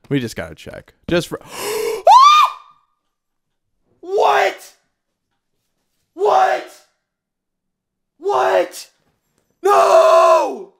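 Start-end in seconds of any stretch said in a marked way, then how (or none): 1.4-2.6 high, loud shrieked gasp; huge squealing gasp of disbelief; shocked gasp. Real, not acted. Shock at finding fifth dungeon. 4.0-10.7 high, shrill disbelieving scream; real squealing shock, building to a loud NO. Real, not acted. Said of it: "I literally screamed like a little girl".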